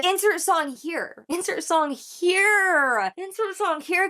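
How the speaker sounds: high, slow singsong repeat; singsong insert. Pretend. Vlogger mocking the rapper's catalogue in a high singsong. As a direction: stretch it out playfully up high. Mock listing of songs, sung up.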